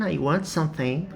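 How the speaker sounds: ordinary talk, nothing marked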